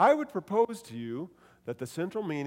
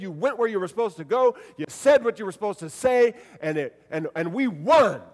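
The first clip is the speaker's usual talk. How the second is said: loud, cheering coach praising his team; proud coach; 3 semitones higher, 4 dB louder than usual. Shown as a demonstration. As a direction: welcome the team back after a big win. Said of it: "like a coach welcoming his team back".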